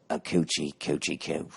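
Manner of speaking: put-on soft, quiet English-accent coo; hushed English-accent cooing. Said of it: "he said".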